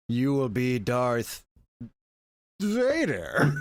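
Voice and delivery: parodying a bored actor's delivery; flat movie line. Retold or imitated. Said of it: "literally delivers the line".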